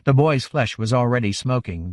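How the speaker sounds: usual speaking voice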